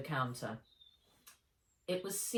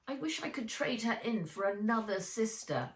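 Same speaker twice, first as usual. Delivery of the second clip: moaning; 6 semitones higher than usual. A narrator's reading. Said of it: "moaned Henry".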